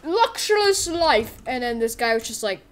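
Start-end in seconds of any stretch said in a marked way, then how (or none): 0.0-1.4 high, showy boast easing off from a bellow; hammed-up bragging; Tuxedo Steve. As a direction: round off the grand boast, pitch still raised.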